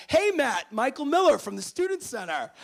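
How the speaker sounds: very high, booming official phone voice; loud wake-up call. Retold or imitated. Student center staffer waking a freshman intentionally, loud and commanding. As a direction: announce yourself loudly to a groggy listener.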